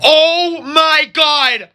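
drawn-out, tearful cry; panicked wail. Acted. Reacting to the dye being put on, panicked wail. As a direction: draw it out in dismay.

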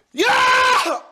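huge high "yeah" scream; throat-straining yell. Real, not acted. Vlogger closing out the video, all-out high scream. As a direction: scream it at the very top of your range.

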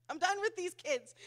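high, mock-exasperated outburst; fed-up parent. Parent at home about the kids, mock exasperation. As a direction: play a parent at the end of their rope. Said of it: "That's me at home".

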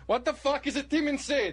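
high-pitched caller imitation in bad Russian accent; mock Russian accent. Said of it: "He turned Russian".

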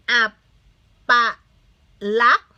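"อัป... ปะ... ลักษณ์" with long pauses between syllables. Shown as a demonstration. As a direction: sound out "อัป ปะ ลักษณ์" one loud, separate syllable at a time. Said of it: "คำนี้อ่านว่าอะไร". (อ่านแยกทีละพยางค์ "อัป - ปะ - ลักษณ์")

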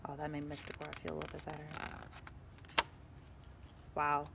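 usual speaking voice

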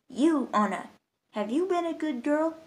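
a hint of a stern bishop asking the child; towering saint. A narrator's reading.

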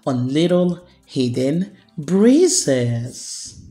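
slow, gentle storybook reading; calm bedtime picture-book voice; reading to children. Acted.

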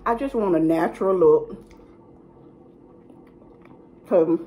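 talking while chewing; words muffled by food; mouth full. Real, not acted. Seen 1: Bites sandwich mid-sentence; chewing visible with food at mouth.

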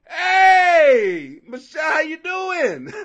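loud greeting. Real, not acted. Greeting a chat viewer loudly.

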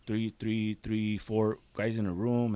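ordinary talk, nothing marked

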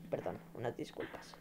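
hushed breathy sorry after a voice crack; whispered apology. Real, not acted. Streamer apologizing to chat after the crack, whispered. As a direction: whisper it, a bit embarrassed.

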